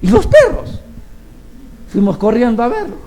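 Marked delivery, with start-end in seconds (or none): 0.0-0.8 high, rising, disbelieving; surprised question. Retold or imitated. The congregation wondering where the dogs were, high and puzzled. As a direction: ask it in sudden disbelief.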